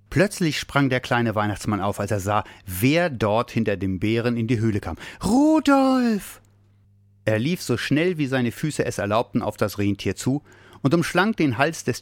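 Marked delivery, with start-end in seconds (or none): 5.2-6.4 high, loud, drawn-out cry of delight; joyful cry. A narrator's reading. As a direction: cry out to a long-missed friend. Overjoyed at seeing his lost friend. (freudiger, heller Aufschrei)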